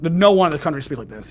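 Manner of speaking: normal talking voice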